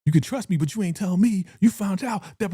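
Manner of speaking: hammed-up wounded friend; imagined Tiff accusing Keisha, hurt; mock betrayed Tiff. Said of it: "'Cause it's like".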